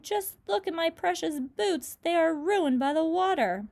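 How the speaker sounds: high, loud complaining wail; fussy lament. Acted. Centipede complains to everyone about his boots, high wail. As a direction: fuss over the boots theatrically.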